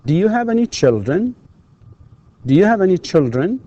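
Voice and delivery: put-on high, clear questions rising on "Egyptian", "teacher", "Cairo", "Riyadh", "children"; rising intonation. Shown as a demonstration. Teacher models questions for students to repeat, rising intonation. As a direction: lift the last word of each question. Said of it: "of course, with rising intonation".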